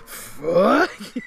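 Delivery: very slow, drawn-out loud curse; mock rage. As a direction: stretch out a high, hammed-up angry yell. Re-enacting the angry grocery outburst.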